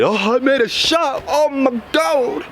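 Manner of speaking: high, squealing mock celebration; over-the-top fake excitement, high pitched. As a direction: gush like you just scored, high and drawn out. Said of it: "getting all excited".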